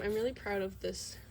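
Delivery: ordinary talk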